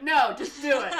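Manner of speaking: loud, laughing high retort; dismissive order spat out through laughter; laughing mock order. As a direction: bark it out while cracking up. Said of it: "it's like".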